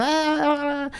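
high, panicky stammering ah ah ah; flustered high ahs. Retold or imitated.

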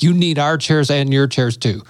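usual speaking voice

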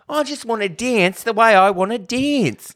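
very high, loud mock Australian; mimicked Aussie accent. Host mimics the film's dancers wanting to dance, high mock Aussie accent. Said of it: "They all sound like that".

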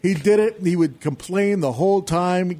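ordinary talk, nothing marked

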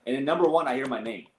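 ordinary talk, nothing marked

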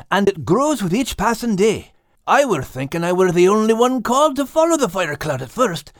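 Scottish lad dreaming of Kate; Max's Scottish voice, dreamy. Acted. Max muses about the Fire Cloud.

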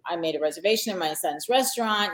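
ordinary delivery

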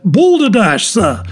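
high-starting, indignant outburst dropping away; angry exclamation. A narrator's reading. Doctor snorting with rage at the lecture, angry exclamation. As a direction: explode in scholarly contempt. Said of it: "he exclaimed angrily".

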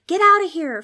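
high, loud shout. A narrator's reading. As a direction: shout to drive it away. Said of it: "Frank shouted at the ghost".

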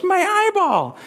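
put-on high toddler squeal; comic small-child voice; Evan. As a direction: blurt it out very high, like a little kid. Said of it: "my brother all of a sudden goes".

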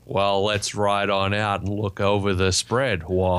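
slow mock American cowboy drawl; badly faked American accent, drawn out. Shown as a demonstration. Host proves their American accent is terrible, bad American drawl. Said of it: "try and do an American accent".

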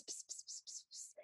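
hushed, voiceless gossip whispering; imitated whispers. Spiders trading gossip, mock whispering. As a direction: whisper secretive nonsense into someone's ear. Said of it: "like, whispers".

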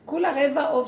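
amused, half-laughing mockery. Real, not acted. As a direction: laugh through the line. Mocking the overloaded mother.

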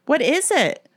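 high, loud startled question; alarmed. A narrator's reading. Erin asking her panicked friends what happened, alarmed. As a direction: cry out in sudden alarm. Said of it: "Erin asked in alarm".

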